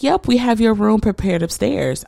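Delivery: high-pitched receptionist impersonation; imitated hospital staff. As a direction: mimic the front desk, pitched well up. Hospital staff answering, voiced.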